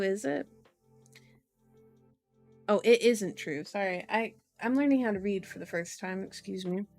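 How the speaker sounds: ordinary delivery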